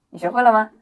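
ordinary delivery

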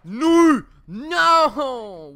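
very loud, high cries of no; panicked shouting. Real, not acted. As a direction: scream as the team gets wiped. Yelling as team is wrecked.

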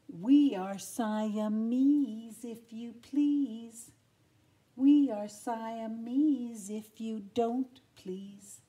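slow, sly drawled cat voice; hissing Siamese cats. Acted. Siamese cats taunting Lady, slow and sly. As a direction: purr out each word like a scheming cat. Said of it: "they hissed".

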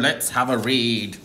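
normal talking voice

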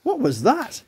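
high, startled question; gasped surprise. Acted. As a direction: react to an unexpected noise from yourself. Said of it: "he gasped".

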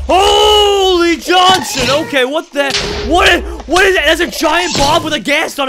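high, loud startled yelling; shocked screaming at a giant bomb; panicked shout. Real, not acted. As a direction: yell in alarm at the bomb.

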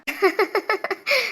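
soft giggle; a quiet laugh after the tease. Acted. Niece teasing her uncle, soft laugh.

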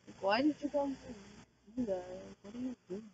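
ordinary delivery

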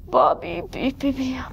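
soft, weary sigh on the words. Acted. Bear closing his eyes, sighing. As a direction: sigh it out, drifting off. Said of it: "sighed the bear".

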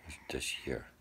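soft unvoiced whisper. Real, not acted. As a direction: whisper it close to his ear. Whispering to sick child.

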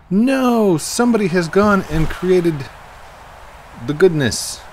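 high, loud protest; dismayed outcry. Real, not acted. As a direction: cry out at an unwelcome discovery. Reacts to change found in game.

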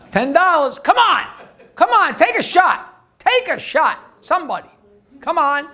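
urging the crowd. Real, not acted. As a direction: call out to the audience to try.

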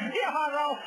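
high, loud shouted name. Acted. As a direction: yell it out. (grito fuerte)

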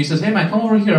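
ordinary delivery

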